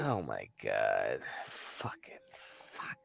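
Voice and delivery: low, slow groan into a whisper; whispered despair. Real, not acted. Hearing Canada grows their peppers, whispered despair. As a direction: mutter it in defeat.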